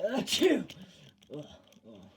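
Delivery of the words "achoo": sneeze. Acted. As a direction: sneeze cutting off the sentence. Pollen makes everyone sneeze.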